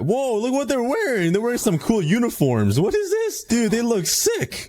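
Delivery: high excited gush over the outfits. Real, not acted. Gamer thrilled by the villagers' uniforms, high and excited. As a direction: sound thrilled at what they're wearing.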